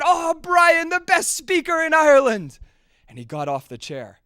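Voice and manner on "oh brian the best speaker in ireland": loud, high crowd cheering. A narrator's reading. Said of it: "they burst into cheers and said".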